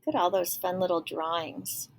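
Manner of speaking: ordinary delivery, nothing marked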